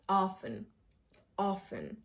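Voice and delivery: often as "off-en", soft; silent T. Shown as a demonstration. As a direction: say often without the T. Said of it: "There is no T".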